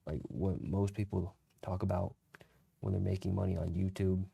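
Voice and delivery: normal talking voice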